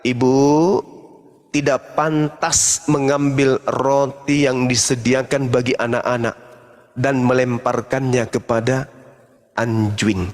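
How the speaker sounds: deep stern rebuke; cold Jesus. Retold or imitated. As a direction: speak down to her, deep and cold. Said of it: "Malah dia mengatakan".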